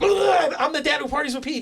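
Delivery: very high, loud outburst; blurted punchline. Retold or imitated. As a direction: belt the joke out as if on stage. Re-performs his punchline on stage.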